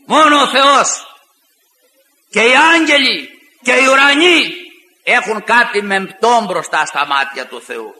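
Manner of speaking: exalt God alone; solemn proclamation; preaching emphasis. Acted.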